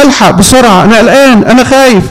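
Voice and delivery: mock frightened plea. Scared man begs his teacher for help, mock panic. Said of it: "فخاف وجري على تلميذه".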